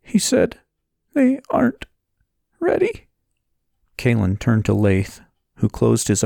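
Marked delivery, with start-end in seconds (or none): 0.0-3.1 very high, halting sorrowful voice; tearful second attempt. A narrator's reading. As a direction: push the words out in high, separated pieces. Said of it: "took a breath and tried again".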